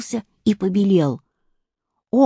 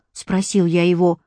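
plain reading voice

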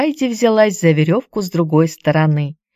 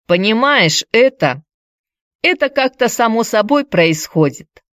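put-on hesitant explaining boy; 5 semitones higher than usual. A narrator's reading. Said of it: "объяснил Петер".

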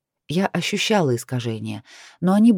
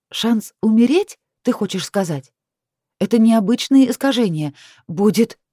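heated; 6 semitones higher, 4 dB louder than usual. A narrator's reading. Heated argument with friends.

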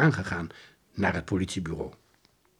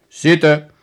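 commanding; 4 semitones higher, 12 dB louder than usual. Acted. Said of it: "beval Van der Lorre".

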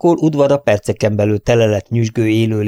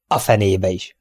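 usual speaking voice

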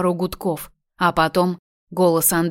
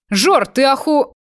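angry outburst, higher and louder, breaks off; cut-off curse; 6 semitones higher, 4 dB louder than usual. Acted. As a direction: start to swear, then stop dead. (гневный возглас, оборванный на полуслове)

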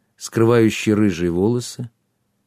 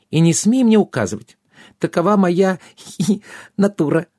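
giggly bossy fairy; 9 semitones higher than usual. A narrator's reading. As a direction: scold playfully in a high voice, then titter. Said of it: "вызвало у неё новый приступ смеха".

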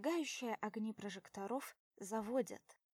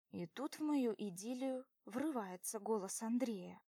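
plain reading voice

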